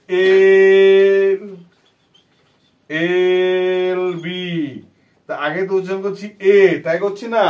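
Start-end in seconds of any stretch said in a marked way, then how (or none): 0.1-4.4 এল এল বি spoken very slowly, the first এল louder; slow drawn-out demo. Shown as a demonstration. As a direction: draw out এল এল বি to show the vowel sound at the start. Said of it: "উচ্চারণ কি হচ্ছে? দেখ". (ধীরে টেনে এল এল বি)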